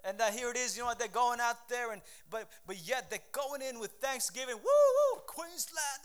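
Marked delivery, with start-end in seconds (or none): 4.6-6.0 high, loud crowd whoop; imitated sports-crowd cheer; cheering supporters. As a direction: whoop like a fan heading into the game.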